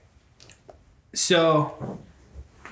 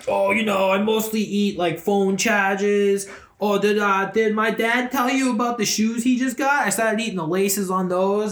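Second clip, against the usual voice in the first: put-on accent for a talking pet; cartoonish pet voice with an odd accent; Larry. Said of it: "I don't know why Larry has that accent".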